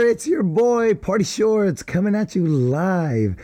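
high, pumped-up show opener; high hype-man greeting; hype intro. Acted. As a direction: kick off the show with big energy. Hyped podcast intro catchphrase.

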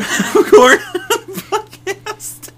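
high and slow, laughing through; cracking up mid-sentence. Real, not acted. Laughs at own prank.

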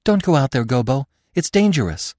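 high, loud urgent plea; pleading. A narrator's reading. Feline begging Gobo to stay back, pleading. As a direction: plead anxiously, pitched high. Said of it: "Feline began to plead".

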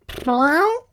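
high, loud yowling cat call; cat's call. Acted. Cat on the wall calls out to his friends, high yowl. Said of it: "he called".

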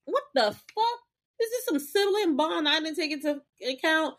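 high, shocked mock disbelief; hammed-up high villain; Tara's disbelief. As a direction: squeal it like a stunned villain. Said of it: "Tara's like".

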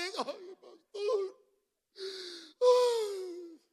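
high, drawn-out fake wail; mock wailing. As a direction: wail to the pastor with hammed-up sorrow. Mimics someone moved by the songs. (lamento agudo y exagerado al pastor)

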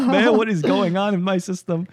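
high, loud, alarmed exclamation; mock panic. Pretending to be dad in an ambulance, mock panic. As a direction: cry out in hammed-up bewilderment.

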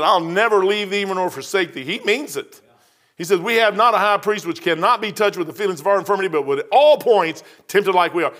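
rising preacher's fervor; fervent preaching. Acted. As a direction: proclaim the promise to the congregation. Preaching scripture at full force.

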